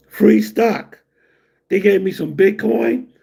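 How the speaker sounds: normal talking voice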